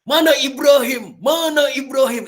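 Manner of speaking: teacher showing the antagonist hunting Ibrahim; villain king's voice. Shown as a demonstration. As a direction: roar the demand like an angry king. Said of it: "Itu pakai suara rendah".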